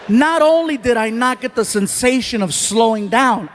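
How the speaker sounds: ordinary talk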